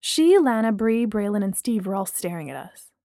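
plain reading voice

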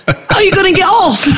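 high, laughing English accent imitation; Pommy accent. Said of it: "this Pommy accent coming through".

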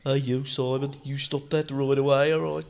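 a light mock Welsh accent; playful Welsh telling-off. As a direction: tell Simon off in a hint of Welsh. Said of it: "me doing a Welsh accent".

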